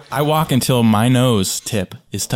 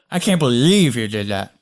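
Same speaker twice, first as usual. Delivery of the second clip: ordinary talk, nothing marked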